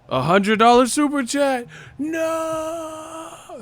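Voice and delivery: high shout rising into a long wail; mock outrage. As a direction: imitate haters howling in disbelief. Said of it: "angry when they see that".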